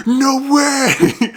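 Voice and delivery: high, stunned "no way"; shocked disbelief. Retold or imitated. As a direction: cry out in disbelief. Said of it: "And you're just like".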